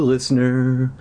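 plain reading voice

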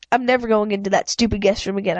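ordinary delivery

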